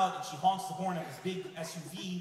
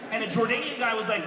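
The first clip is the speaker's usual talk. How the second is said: normal talking voice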